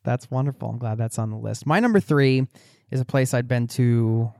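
normal talking voice

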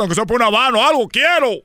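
ruego cada vez más agudo y lento, con risa; súplica lloriqueada. Acted. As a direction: implora como un niño que ya no puede más. Ruega al retrato por dinero. (súplica agudísima y arrastrada)

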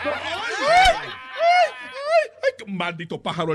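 very high mock-swooning ay-ay, dropping to scorn; mocking squeals. Husband mocking the man who says he's good-looking, squealing derisively. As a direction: squeal in mockery, then drop into contempt. Mocks the man's boast of looks.

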